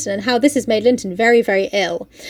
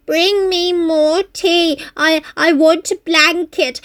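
higher, slow pampered whining demands; whiny sickly Linton; 6 semitones higher, slower than usual. Retold or imitated. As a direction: whine like a spoiled invalid. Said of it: "he's crying out".

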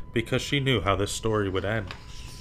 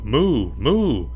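loud cow moo; 6 dB louder than usual. Acted. Imitating a cow.

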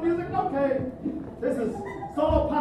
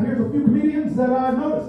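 usual speaking voice

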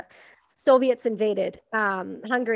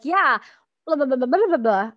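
mumbled nonsense in an imitated thick Scottish accent, louder; mock thick accent; 3 semitones higher, 5 dB louder than usual. Mimicking his Scottish army mates' thick accent. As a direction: gabble it like a Scot nobody can follow. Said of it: "They're like".

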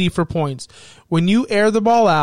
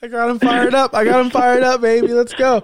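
gleeful gloating; 6 semitones higher than usual. Real, not acted. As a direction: crow about winning the argument. Gloating after riling his friend.

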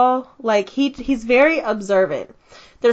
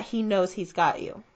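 ordinary talk

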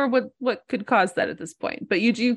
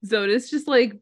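ordinary talk, nothing marked

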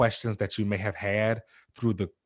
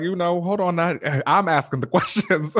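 higher, playfully indignant Wanda; mock Wanda; 9 semitones higher, 5 dB louder than usual. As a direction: brush him off like she's in charge. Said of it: "She was like".

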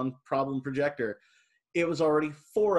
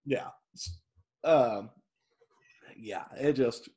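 ordinary delivery

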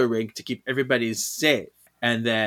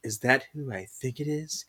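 softer, awed voice; a hint of awe; awed hush; 3 semitones lower, 8 dB quieter than usual. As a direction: gasp it out in quiet wonder. Piper's awed recognition.

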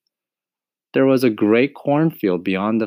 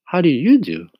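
put-on higher, polite little girl; a polite child returning the greeting; 8 semitones higher, 5 dB louder than usual. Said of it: "replied Dorothy politely".

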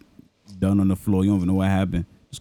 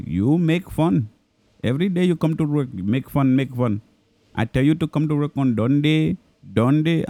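higher caricature Indian boss scolding; mock Indian accent; 4 semitones higher than usual. Boss telling off the clerk who mimicked him, mock Indian accent. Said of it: "I was mimicking my boss at seven eleven".

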